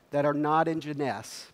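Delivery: ordinary talk, nothing marked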